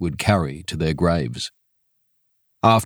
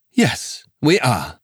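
higher polite voice; missionary; 4 semitones higher, slower than usual. Acted. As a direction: reverend answering quickly. Missionary answers.

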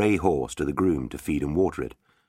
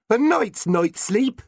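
mocking; 4 dB louder, slower than usual. Village fool jokes.

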